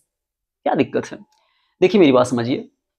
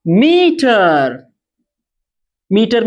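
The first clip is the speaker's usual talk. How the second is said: very drawn-out dictation; announcing example word; 3 semitones higher, much slower than usual. Shown as a demonstration. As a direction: stretch the word out slowly for the class. Teacher calls out next example word. (शब्द को खूब खींचकर बोलते हुए)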